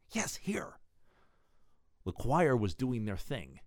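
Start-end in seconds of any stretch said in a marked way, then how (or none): 0.1-0.8 soft whispered confirmation. Acted. Answering a puzzled latecomer in a church pew, whispered. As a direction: whisper back under the breath.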